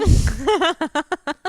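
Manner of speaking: loud, high peal of laughter; laughing. Real, not acted. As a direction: burst out laughing at your own story. Laughs after retold deadpan answer.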